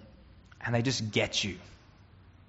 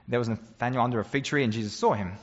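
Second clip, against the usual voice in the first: usual speaking voice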